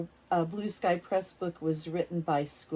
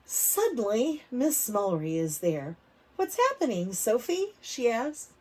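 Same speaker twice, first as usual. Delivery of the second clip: put-on reading to children; 5 dB louder than usual. A narrator's reading. Teacher reading a picture book aloud to kids. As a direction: lively, clear storybook reading for little ones.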